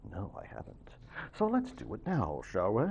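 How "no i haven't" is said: unvoiced whisper answering her own question; whispered aside. Real, not acted. Teacher whispers to her class, answering herself. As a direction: breathe it as a private aside.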